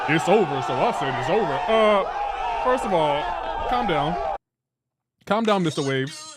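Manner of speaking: loud, hammed-up dad voice. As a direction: imitate a dad shutting down an argument, loudly. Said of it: "use his dad voice on us".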